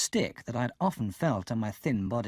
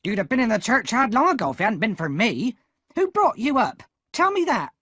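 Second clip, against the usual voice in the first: much higher, louder shrill scold; angry shout; 10 semitones higher, 7 dB louder than usual. A narrator's reading. Sister scolding Pip for coming home late, shouting. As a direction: yell at the boy furiously.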